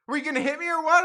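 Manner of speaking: loud, high, outraged demand; hammed-up confrontational question; mock angry accusation. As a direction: imitate an irate stranger picking a fight. Acts out the lady's accusation.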